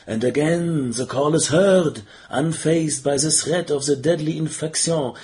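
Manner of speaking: ordinary delivery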